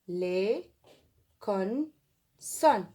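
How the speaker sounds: "les consonnes" broken into les, con, sonnes; syllable-by-syllable dictation. Shown as a demonstration. Teacher writing along with pupils, spaced-out dictation. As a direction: say "les consonnes" with long pauses between syllables.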